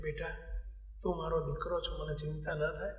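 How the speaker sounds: soft, low voice of a worried mother; understated tearful plea, quiet and low; retold tearful mother. Mother to her sick son, softly imitating tearful worry. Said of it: "એની mummy રડતા રડતા કહે છે".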